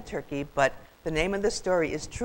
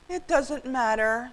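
slow, high, weary resignation; drawn-out, defeated; sighing; 7 semitones higher, slower than usual. A narrator's reading. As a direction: sigh the words out listlessly. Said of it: "he sighed".

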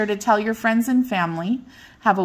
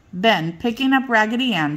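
plain reading voice

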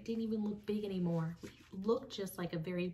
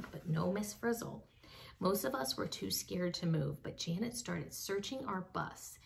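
ordinary delivery, nothing marked

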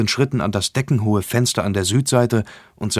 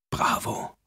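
whispered Bravo; quiet; 8 dB quieter than usual. A narrator's reading. Shadow Rider whispering to the disarmed Paul, quiet. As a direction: breathe it out softly, menacing. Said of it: "sagte der Schattenreiter leise".

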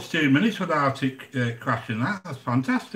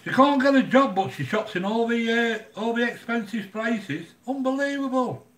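much higher, teasing indignation; mock outrage; 10 semitones higher than usual. Vlogger teasing a posh viewer, high mock outrage. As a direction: ham up the shock.

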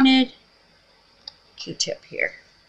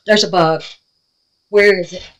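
sudden loud alarmed cry; startled by a bug; 10 dB louder than usual. Real, not acted. Spotting a bug on her painting, loud alarm. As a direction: jump at the sight of a bug.

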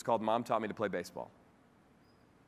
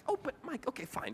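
exasperated mom; 13 semitones higher, 5 dB quieter than usual. Retold or imitated.

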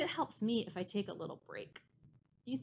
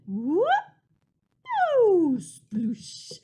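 loud whoop then splashy sploosh; silly splash sound; 8 semitones higher, 10 dB louder, much slower than usual. Shown as a demonstration. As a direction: shout it like jumping into paint. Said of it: "silly sound ... for all of the times the mice jump".

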